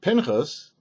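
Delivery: ordinary delivery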